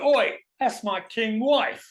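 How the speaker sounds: slow, high, loud Oi; a hammed-up cross husband; husband confronts the robot, mock outraged; mock angry husband. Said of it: "the guy comes up to me and goes".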